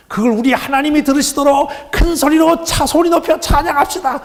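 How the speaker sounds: mimicked praise leader at a very high pitch; mock rousing shout, loud and high; worship leader. Worship leader urging everyone to raise their voices, high mocking imitation. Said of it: "찬양 인도하는 사람이 그러더라고요".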